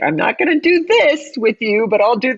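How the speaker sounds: high-pitched self-parody, breaking into laughter; mock bargaining. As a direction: imitate yourself haggling on a date, hammed up. Mimics her dating self bargaining, laughing.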